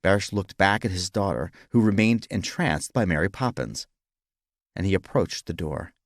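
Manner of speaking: usual speaking voice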